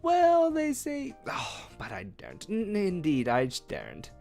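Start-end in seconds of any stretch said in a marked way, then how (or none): 1.3-2.5 whispered, breaking off in fear; fearful whisper. A narrator's reading. Page whispers in fear to the prisoner. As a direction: whisper it as if afraid to be overheard.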